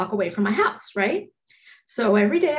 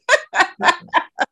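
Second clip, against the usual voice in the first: high burst of laughter; 9 semitones higher, 5 dB louder than usual. Real, not acted. Laughing at being dive-bombed.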